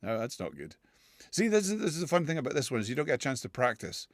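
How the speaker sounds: normal talking voice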